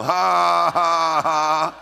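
loud, sustained, deliberate "ha ha ha"; defiant mock laugh. Shown as a demonstration. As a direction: answer the devil with a put-on laugh. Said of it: "You go".

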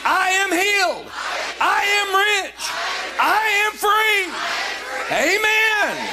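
high, drawn-out preacher's shout; shouted declaration. Real, not acted. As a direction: proclaim it to rouse the congregation. Preacher leads congregation in shouted declaration.

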